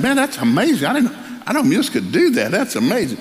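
high, wide-eyed amazement; amazed. Retold or imitated. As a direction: coach astonished at the mule's pull. Coach amazed by the mule.

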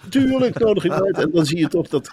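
high-pitched mock royal delight; royal host. Royal couple choosing a lunch guest, high acted delight.

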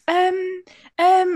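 high stalling um. Retold or imitated. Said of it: "I was like".

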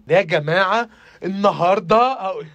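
drawn-out imitation of a vlogger greeting; mock influencer opener, slow and emphatic; gushing influencer intro. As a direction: stretch it out like an influencer opening a video. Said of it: "من اللي بتبدا كلامها كده".

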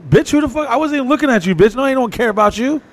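mock outrage. Guy snapping back at the girl who fled, high mock rant. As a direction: squeal your offended reply. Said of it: "I'm like".

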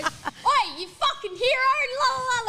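Ella leaning out the window at the blocking guy, screaming mockery. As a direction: hurl it out the window at him. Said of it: "screaming like this".

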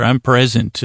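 ordinary delivery

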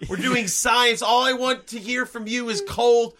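emphatic pretend outrage; mock angry rant. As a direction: rant as a ruthless scientist. Improvised hard-nosed scientist rant.

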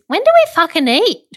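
mock impatient whine, pitched high; impatient complaint. Teasing a friend about dinner, mock impatient.

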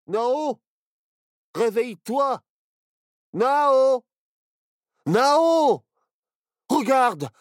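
put-on panicked excited boy. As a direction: shake your friend awake, shouting. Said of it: "s'excita Barnabé".

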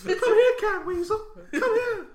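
high, breathy pop-star falsetto; squeaky [name] impression. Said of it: "[name]'s voice".

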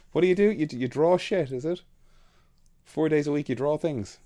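high, mocking disbelief; mock incredulous teasing. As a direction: tease him about his easy job. Teasing coworker about job.

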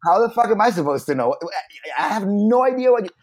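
a hint of a rider losing patience; mock exasperation. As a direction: blurt what the rider really thinks. Voicing the rider's true thoughts.

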